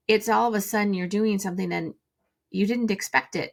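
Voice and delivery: ordinary talk, nothing marked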